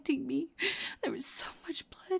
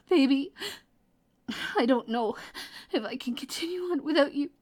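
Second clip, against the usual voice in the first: tearful pleading; 3 semitones higher, 5 dB louder than usual. Acted. Begging a wounded lover to wake up, crying. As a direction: plead through tears, voice climbing high.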